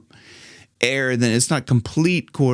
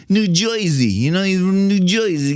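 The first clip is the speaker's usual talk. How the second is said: put-on raised-pitch New Jersey accent; 5 semitones higher than usual. Coach explaining where the singer's from, Jersey accent. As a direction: lay on a thick Jersey "Joisey". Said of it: "got that Jersey accent".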